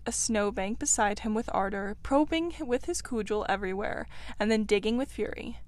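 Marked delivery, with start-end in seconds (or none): none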